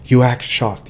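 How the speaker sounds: usual speaking voice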